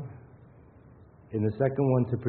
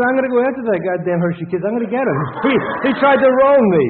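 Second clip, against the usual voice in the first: loud, fast, higher South Philly outburst, climbing to a yell; mock angry South Philly rant; 12 semitones higher, 12 dB louder than usual. Retelling the man at Hershey Park, hammed-up angry South Philly shout. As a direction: ham up a furious South Philly guy getting ripped off. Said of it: "a very heavy South Philly accent".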